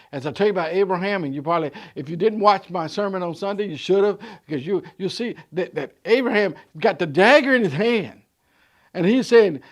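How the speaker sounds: loud rising preacher's call; full-force preaching. Acted. Preacher building to a shout for the church. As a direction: preach it out to the congregation.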